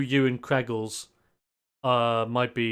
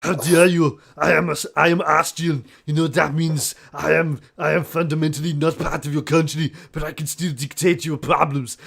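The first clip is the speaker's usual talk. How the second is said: put-on louder, Austrian accented tirade; Arnold Schwarzenegger impression; 2 semitones higher, 4 dB louder than usual. Player rants as Austrian strongman in Austrian accent. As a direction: rant in a heavy Austrian accent. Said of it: "Arnold Schwarzenegger".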